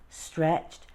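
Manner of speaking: ordinary delivery, nothing marked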